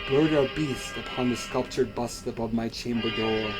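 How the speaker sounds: usual speaking voice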